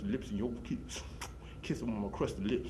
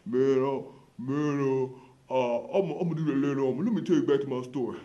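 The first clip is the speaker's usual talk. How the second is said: louder, put-on voice of a boastful hub regular; guy at the hub; 4 semitones higher, 7 dB louder than usual. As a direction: play the bragging older man at the bus stop. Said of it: "He said".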